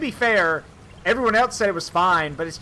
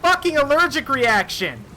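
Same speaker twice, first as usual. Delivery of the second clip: higher, strained, exasperated retort through the door; exasperated yell; 7 semitones higher than usual. Retold or imitated. Son snaps at his father through the bathroom door, exasperated. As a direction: snap back in exasperation. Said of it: "I'm like".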